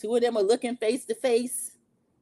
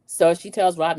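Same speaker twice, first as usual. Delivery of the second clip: normal talking voice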